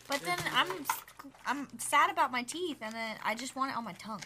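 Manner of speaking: sucking a lollipop. Real, not acted. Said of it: "sucking on a lollipop".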